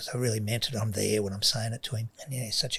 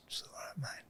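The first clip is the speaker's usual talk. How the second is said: soft reassurance; 15 dB quieter than usual. Retold or imitated. Father gently reassures son.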